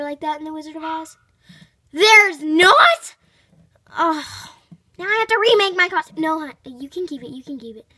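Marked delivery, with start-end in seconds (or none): 1.9-6.2 loud, fast dismayed outburst; shocked dismay. Acted. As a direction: blurt out the bad news shock. Dismayed to learn no detective.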